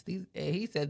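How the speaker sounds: ordinary delivery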